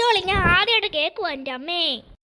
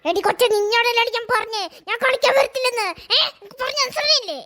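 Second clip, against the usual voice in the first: put-on a spoiled doll telling the kid off; sassy doll voice; 3 semitones higher than usual. Doll scolding the girl who wants to play.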